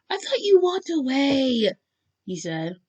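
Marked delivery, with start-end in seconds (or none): 0.1-1.7 higher, louder, drawn-out protest; startled protest. A narrator's reading. Caught listener to Bakugo, startled protest. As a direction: protest slowly, pitched up in disbelief.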